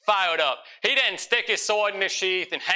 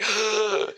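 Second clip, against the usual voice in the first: winded gasp; 6 semitones higher than usual. Retold or imitated. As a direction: gasp for air between words. Winded after two football plays.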